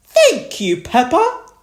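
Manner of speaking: put-on high, loud old rabbit thanks; Grampy Rabbit. A narrator's reading. Grampy Rabbit thanks Peppa, high and loud. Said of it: "Grampy Rabbit said".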